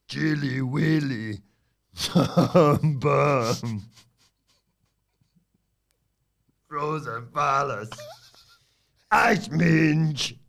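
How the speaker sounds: lazy hissy iguana; slow, drawn-out lizard voice; Mister Iguana voice. Pretend. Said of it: "do them in the Mister Iguana voice".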